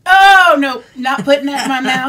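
disgusted outcry. Real, not acted. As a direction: yelp no, laughing in disgust. Refusing licorice, loud laughing.

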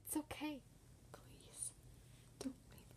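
faint breathy plea; barely whisper, fading. Acted. Dying girl's faint plea.

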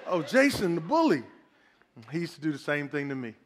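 low, easy big-brother voice with a chuckle; amused older brother reassuring the kid. Acted. Preacher voicing the big brother calming the little boy, low and amused. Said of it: "his big brother comes in the room".

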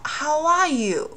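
how are you glided as how-w-are-you, slowly; slow linking demo; linked with w. Shown as a demonstration. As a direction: slide a w into are. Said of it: "听一下".

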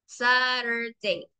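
Saturday said very slowly, every sound stretched; slow model word. Shown as a demonstration. Teacher models Saturday slowly to quiz the class. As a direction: spell out Saturday aloud by sound, unhurried. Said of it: "Suena como U".

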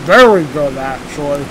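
slightly full mouth; muffled by food; talking while chewing. Real, not acted. Seen 0: Bites cookie at -5, holds it near mouth, jaw working throughout.

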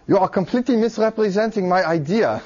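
hammed-up German accent impression; a bad German accent, played for laughs; Alan Turing, German accent. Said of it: "what a bad imitation".

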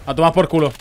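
ordinary talk, nothing marked

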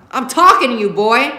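loud, pointed demand; angry demand. Acted. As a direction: force the son to answer. Father demanding son answer.

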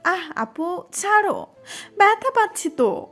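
put-on high girlish whine of pain; "ow, that hurts" complaint; pained protest. A narrator's reading. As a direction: plead to be let go, pitch climbing. Cousin's ear is being pulled.

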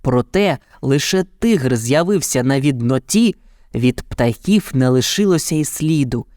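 ordinary delivery, nothing marked

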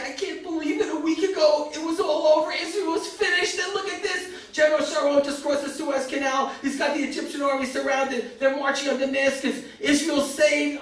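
mild mock joyful crying; tears of joy. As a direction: retell his happy weeping, overwhelmed. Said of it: "he was crying with simcha".

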